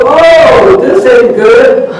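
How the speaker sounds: very high yelp, then slow groan; yelp of pain. Acted. Coyote burned by the hot poker, yelping in pain. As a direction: yowl as the poker hits.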